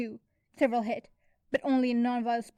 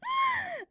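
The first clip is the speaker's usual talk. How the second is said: high gasp bursting into laughter; a sharp gasp as the joke lands. A narrator's reading. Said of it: "laughing hysterically".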